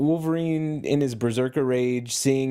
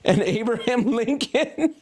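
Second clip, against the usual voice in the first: laughing; 12 semitones higher than usual. Real, not acted. Absurd list ends in Lincoln.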